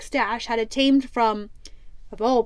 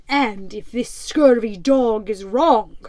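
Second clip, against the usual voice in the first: louder menacing pirate threat; 5 dB louder than usual. Acted. Captain threatens the terrified prisoner, menacing. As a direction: loom over the prisoner with menace.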